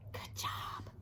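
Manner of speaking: soft whispered praise. Real, not acted. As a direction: whisper it warmly. Praising children softly.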